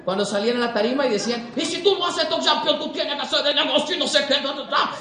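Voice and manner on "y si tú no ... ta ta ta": hammed-up Dominican or Puerto Rican accent, pitched up; Caribbean preacher-style accent. Dominican speaker on stage firing up recruits, mock Caribbean accent. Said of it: "escuchar hablando un dominicano o un puertorriqueño".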